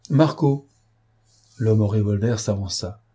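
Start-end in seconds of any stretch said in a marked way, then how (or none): 0.1-0.7 raised, higher call to a henchman. A narrator's reading. Stranger summons his accomplice after a pause, calling out.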